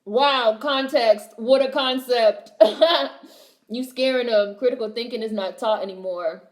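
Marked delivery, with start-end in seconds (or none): 0.1-2.5 louder, slow, mock-amazed; sarcastic awe. Real, not acted. Vlogger mocking critics, slow sarcastic wonder. 2.6-3.2 high, loud burst of laughter. Real, not acted. Vlogger laughing at her own sarcasm.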